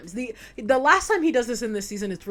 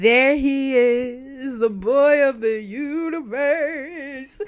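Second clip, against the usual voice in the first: slow, louder grand announcement; hammed-up reveal of the rival; dramatic reveal; 4 semitones higher, 7 dB louder, much slower than usual. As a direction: present him like a hero entering. Dramatic slow intro of Shadow.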